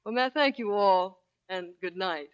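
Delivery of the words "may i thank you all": ordinary delivery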